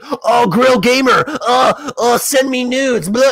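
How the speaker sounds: high, slow, sleazy mock voice of a creep hitting on a girl gamer; mocking creepy gamer guy. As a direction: ham up a leering guy in voice chat. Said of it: "some dude's like".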